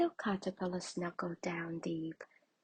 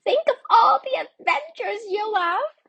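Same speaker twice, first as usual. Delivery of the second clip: very high, loud tiny ant; a teeny, eager insect voice; 17 dB louder than usual. A narrator's reading. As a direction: squeak out the promise of adventure. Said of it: "said a tiny ant".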